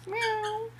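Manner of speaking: high cat-like meow; a bird's imitation meow. Real, not acted. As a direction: mew like a kitty. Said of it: "Are you a kitty?".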